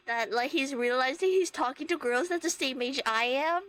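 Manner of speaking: ordinary delivery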